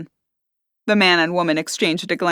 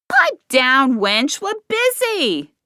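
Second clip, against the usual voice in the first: higher, slow snide retort; 7 semitones higher, much slower than usual. A narrator's reading. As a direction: sneer it at the rival. Barmaid snapping back.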